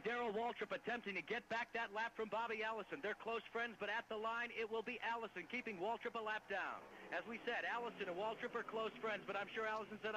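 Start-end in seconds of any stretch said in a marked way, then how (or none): 0.0-9.0 motorsport commentator. Acted. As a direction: call the battle to the line. Battle to the line call.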